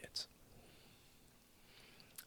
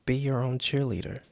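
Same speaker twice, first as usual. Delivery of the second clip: usual speaking voice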